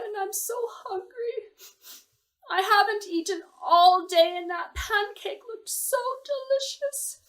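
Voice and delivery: put-on high, sobbing little-girl voice; little girl crying. A narrator's reading. Hungry girl begs the pancake, sobbing in a high voice. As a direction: weep it out like a hungry child. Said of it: "And she kept crying".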